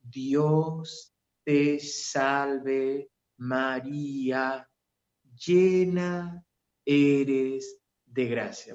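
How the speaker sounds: exaggeratedly slow, stretched words; slow motion. Shown as a demonstration. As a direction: drag the prayer out absurdly. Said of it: "parece cámara lenta". (en cámara lenta, exageradamente lento)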